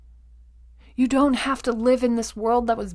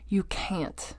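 whispered; 10 dB quieter than usual. Real, not acted. Coach to listener, hammering the point home, whispered. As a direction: drop to a hushed whisper for emphasis.

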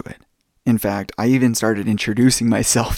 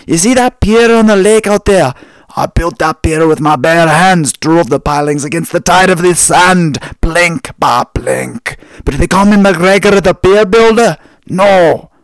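put-on loud, higher old Scottish barman brogue; Scottish accent; 7 semitones higher, 11 dB louder than usual. Old Scot boasting to a young tourist, loud Scottish accent. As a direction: bellow it like a proud old Highland Scot. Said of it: "the old Scottish guy".

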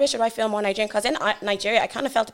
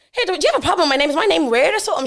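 higher-pitched, mildly indignant retort; indignant challenge; 6 semitones higher, 4 dB louder than usual. Retold or imitated. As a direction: replay challenging someone over your name. Acted confrontation with taxi driver.

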